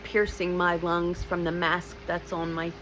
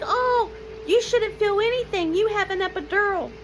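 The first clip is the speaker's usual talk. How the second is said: dismissive doctor; 14 semitones higher than usual. Retold or imitated. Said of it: "She's like".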